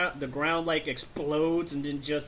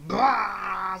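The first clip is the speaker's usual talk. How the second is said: short loud mock roar; power-up yell; 4 semitones higher, 6 dB louder than usual. Vegeta powering up to Super Saiyan, loud mock yell. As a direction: burst out with a drawn-out battle cry.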